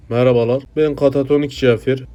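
normal talking voice

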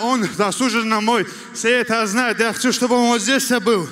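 ordinary talk, nothing marked

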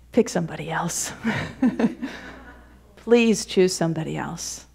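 soft, laughing plea; pleading Moses. Acted. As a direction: Moses to God, begging to be excused, pleading.

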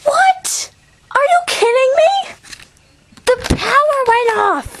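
high, shouted disbelief; shocked outrage. Real, not acted. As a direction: shriek at the dead screen. Game power cuts out.

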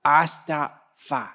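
put-on slow, childlike mumble of a two-year-old; toddler voice. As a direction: mimic a toddler who can't say his consonants yet. Toddler son repeats his words.

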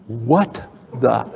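low, halting, cut-off shock; stunned disbelief. Acted. Speaker seeing how many emails went out, stunned and cut short. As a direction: break off before the curse.

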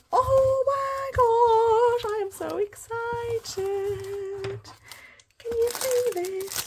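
high, drawn-out squeal of delight; excited squeal. Real, not acted. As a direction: squeal it slowly, stretching the words. Unboxing, thrilled at the parcel.

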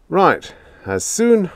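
slow, high sarcastic drawl. A narrator's reading. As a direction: say it with heavy irony. Sarcastic answer to chief.

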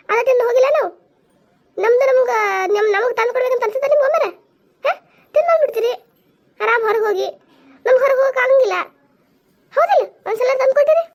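high-pitched wifely nagging; shouting complaint. Acted. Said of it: "ಸುಮ್ಮ ಯಾಕೆ ಅವ್ರ ಮ್ಯಾಗ ಒದ್ರಾಡ್ತೀರಿ". (ಗಂಡನ ಮೇಲೆ ಒದರಾಟ)

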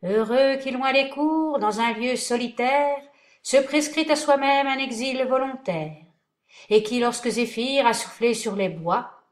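high, loud declaiming; pompous verse. Acted. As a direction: recite like a vain actor. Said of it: "jouant le rôle de Phédon".

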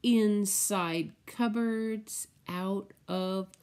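slow, sing-song picture book read; reading to children. Acted. Teacher reading the spider book to children, slow and sing-song. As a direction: savour each spider word for little ones.

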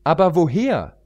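put-on high, eager young pony voice; pony heroine. Pony heroine questioning the queen, high and urgent.

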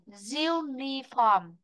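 very slow, stretched "uniform", syllable by syllable; demonstrated pronunciation. Shown as a demonstration. Teacher showing students how to pronounce it, slow stretched "uniform". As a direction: draw out the first syllable of "uniform", YOO-ni-form. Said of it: "từ này chúng ta phát âm này".

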